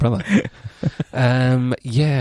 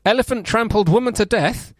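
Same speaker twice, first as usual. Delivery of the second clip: headline read-out; 9 semitones higher than usual. Real, not acted. Co-host reading the whole headline from the beginning, announcer-like headline delivery. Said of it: "I wanna read the whole headline".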